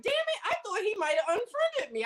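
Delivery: high-pitched retold exclamation; mock dismay. Seeing friend pop up online, high mock dismay. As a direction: squeak it up high, as inner dismay.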